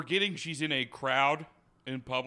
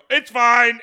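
curt retort; 9 semitones higher, 13 dB louder than usual. Retold or imitated. Cutting off a waterpark employee.

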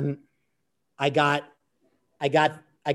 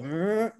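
imitated muffled "mm", pitched up at the end; gagged mumble; 5 semitones higher than usual. Wrestler re-enacting his gagged mumble to locate the captor, muffled mumble. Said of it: "and I mumble".

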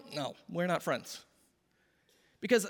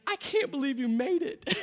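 teary delighted quote; 6 semitones higher than usual. Retold or imitated. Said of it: "And she was crying".

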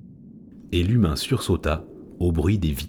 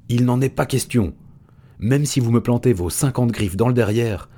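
yelping chimpanzee; 6 semitones higher than usual. A narrator's reading. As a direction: yap it out like a cranky ape. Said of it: "glapit le chimpanzé".

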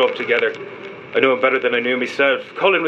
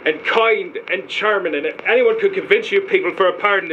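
higher-pitched, earnest praise; emotional, pitched up, insisting on each virtue; impassioned defence; 6 semitones higher than usual. Acted. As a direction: plead for your friend's good name. Pleads friend's good character.

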